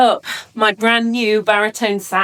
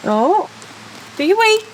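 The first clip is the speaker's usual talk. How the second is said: coaxing the puppy; 10 semitones higher than usual. Real, not acted. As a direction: urge the dog on brightly.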